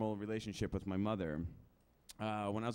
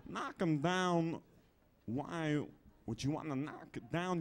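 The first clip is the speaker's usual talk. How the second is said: slow, halting, oddly broken phrases; puzzled, deliberate character voice; celebrity impression; slower than usual. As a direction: stretch words and pause in strange places. Walken-style impression answers himself.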